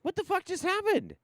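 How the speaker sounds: very high, loud incredulous squeal; utter disbelief. Real, not acted. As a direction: squeal it in total bafflement. Baffled as the audio fails.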